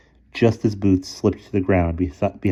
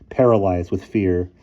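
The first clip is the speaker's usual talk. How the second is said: ordinary delivery, nothing marked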